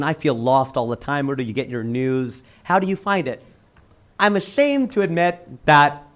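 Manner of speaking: ordinary delivery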